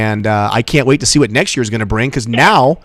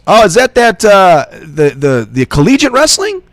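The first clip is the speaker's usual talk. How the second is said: higher, halting, groping for the word; louder, hesitant mock confusion; puzzled stranger, imitated; 7 semitones higher, 4 dB louder, slower than usual. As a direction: voice a clueless bystander fumbling a question. Clueless stranger voiced in story.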